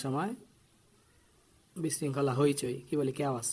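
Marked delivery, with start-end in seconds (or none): none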